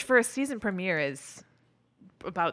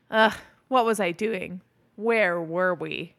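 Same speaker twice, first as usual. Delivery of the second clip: slower, dragged-out muddled take; mock fuddled, slowing down; a slowed, sleepy reading of the line; slow groggy take. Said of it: "He does a couple different takes of that".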